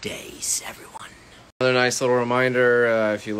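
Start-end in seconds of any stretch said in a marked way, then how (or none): 0.0-1.1 whisper. Acted. Sudden whisper mid-greeting.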